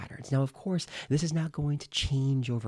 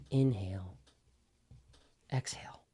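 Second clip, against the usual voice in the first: breathy whisper; 5 semitones lower, 5 dB quieter than usual. Shown as a demonstration.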